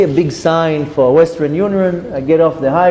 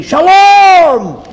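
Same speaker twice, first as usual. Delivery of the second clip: loud, high-pitched drawn-out holler; screamed greeting; 7 dB louder than usual. Retold or imitated. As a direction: scream it across a crowded room. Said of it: "this guy screams to me".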